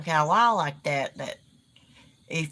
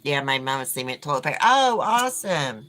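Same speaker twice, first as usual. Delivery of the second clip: usual speaking voice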